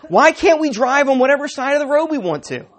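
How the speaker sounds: ordinary talk, nothing marked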